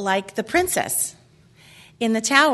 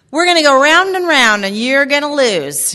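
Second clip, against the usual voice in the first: loud, raised Southern mock threat; Southern accent; 4 semitones higher, 7 dB louder than usual. Speaker quoting her mom's threat to the audience, Southern accent. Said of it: "You have to say that one with an accent".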